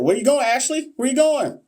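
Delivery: high-pitched call after a departing viewer; playful call-out. Real, not acted. Host calls after Ashley leaving the live, high teasing call-out. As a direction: sing out a teasing where you going.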